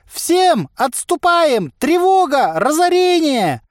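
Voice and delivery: very high, drawn-out shouts; hammed-up panicked wailing; crying out in alarm. A narrator's reading. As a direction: wail a slow, loud alarm. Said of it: "возопил Харчик".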